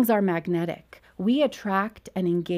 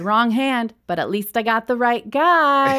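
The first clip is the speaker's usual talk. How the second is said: raised, louder retold quip to the crowd; quick-witted comeback; 4 semitones higher, 5 dB louder than usual. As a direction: toss back a punchline, voice lifted. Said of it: "had to think quick, so I said".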